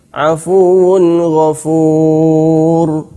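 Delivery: Afuwun ghafur very slow, tanwin clear; Quran recitation. Shown as a demonstration. Teacher giving tanwin before ghain example. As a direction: chant Afuwun ghafur slowly. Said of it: "tanwin bertemu dengan ghain".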